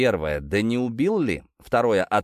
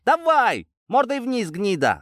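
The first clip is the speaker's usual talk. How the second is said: furious yell, much higher and louder; angry shouting; 13 semitones higher, 4 dB louder than usual. Acted. Sergeant pinning the detainee face down, furious shout. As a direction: scream it down at him in rage. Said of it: "зло скомандовал сержант".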